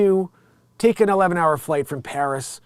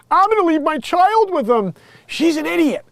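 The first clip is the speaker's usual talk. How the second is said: high mocking woman's voice, louder; mock ditzy woman; 11 semitones higher, 5 dB louder than usual. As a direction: squeal it out like a careless mother mocked.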